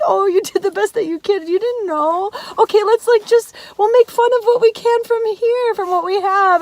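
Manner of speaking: high, tender reassuring dad voice; loving dad. Retold or imitated. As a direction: comfort her lovingly like dad.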